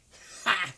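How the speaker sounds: short high burst of laughter; a quick amused laugh. Real, not acted. Amused at talkative assistant.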